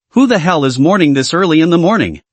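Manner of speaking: usual speaking voice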